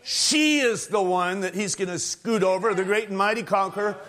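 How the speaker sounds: swelling pulpit cry; full-force preaching. Acted. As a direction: preach it out, building toward the throne.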